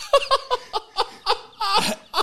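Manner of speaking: high, breathless burst of real laughter; cracking up at his own joke; high wheezing laugh that won't stop; helpless laughing fit. Real, not acted.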